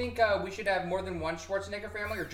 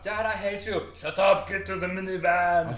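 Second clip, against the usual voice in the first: loud, hammed-up Austrian family row; Arnold Schwarzenegger; 2 semitones higher, 8 dB louder, slower than usual. Rebellious teenage girl and dad quarrelling, loud Austrian accent. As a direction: yell it in a thick mock Austrian accent. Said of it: "every character is like Arnold Schwarzenegger".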